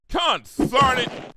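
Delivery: slow, loud furious country shout; fit of fury. Acted. Applejack furious about Twilight's blabbing, slow loud shout. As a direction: stamp it out in anger. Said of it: "in a fit of fury".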